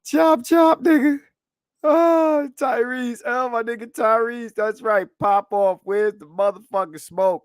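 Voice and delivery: very high, loud excited greeting; hyped. Real, not acted. Streamer greets Tyrese's super chat, hyped and loud.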